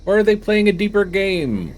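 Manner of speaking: high, loud dramatic question; hammed-up suspense. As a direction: push the voice way up for mock intrigue. Hamming up quest text's suspenseful question.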